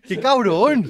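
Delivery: high, loud outburst of disbelief; loud exclamation. Real, not acted. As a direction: shout it out, still rattled. After the shock.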